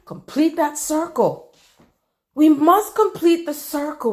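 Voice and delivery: ordinary talk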